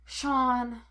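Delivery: tired, understated appeal; a touch of fatherly weariness; weary. A narrator's reading. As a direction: say the name wearily. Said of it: "Henry said wearily".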